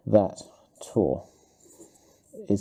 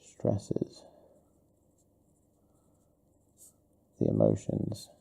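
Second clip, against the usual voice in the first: murmured dictation; 5 dB quieter, slower than usual. Real, not acted. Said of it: "I'm gonna put this".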